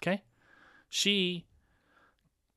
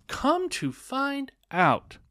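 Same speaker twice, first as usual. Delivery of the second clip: higher and drawn out very slowly; slow dramatic repeat; 12 semitones higher, 3 dB louder, much slower than usual. Real, not acted. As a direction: stretch it out for suspense.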